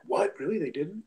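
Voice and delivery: high-pitched startled "what", then easing down; acting out hoped-for disbelief; mock surprise. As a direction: imitate someone baffled on their behalf. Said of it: "the reaction I would have hoped for".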